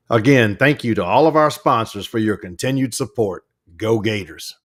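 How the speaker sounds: announcer reading sponsors; PA announcer thanking sponsors. Acted. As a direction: read the sponsor list like a PA ad. Sponsor read.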